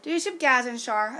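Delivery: ordinary talk, nothing marked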